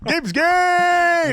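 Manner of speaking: very high, loud holler; stretched, high-pitched shout; catchphrase scream. Pretend. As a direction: belt it out playfully. Yelling the catchphrase as a bit.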